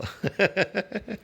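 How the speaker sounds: amused laugh. Real, not acted. Laughs at his own joke.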